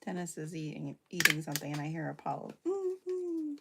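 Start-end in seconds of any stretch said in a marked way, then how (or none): 2.7-3.5 high nasal-less whimper, dog crying; imitated dog whine. Owner imitating the dog crying for him, high whimper. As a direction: mimic the dog's whine, high. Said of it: "crying to him".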